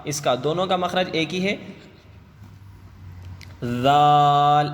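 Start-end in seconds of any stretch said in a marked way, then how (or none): 3.6-4.7 ذال held very long, sung loud; teacher chanting ذال slowly; long soft letter. Shown as a demonstration. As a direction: stretch ذال three alif lengths, lightly. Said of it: "ہلکے سے ادا کرنا چاہیے".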